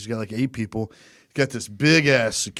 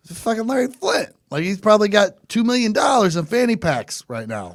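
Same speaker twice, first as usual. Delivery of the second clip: much higher, laughing disbelief; higher, laughing outrage at the casino's refusal; exasperated disbelief; 6 semitones higher than usual. Retold or imitated. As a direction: pitch way up, can't believe the casino. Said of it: "And I'm like".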